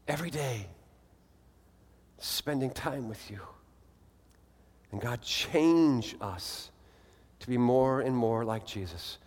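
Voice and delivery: slow, fervent prayer rising and fading; closing prayer. Acted. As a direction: pray earnestly over the congregation.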